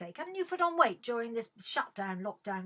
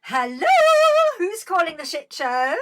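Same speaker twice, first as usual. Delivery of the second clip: showy phone greeting; 6 semitones higher, 13 dB louder than usual. Real, not acted. Host greets a caller to the shit show, showy. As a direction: answer the phone like a big announcement.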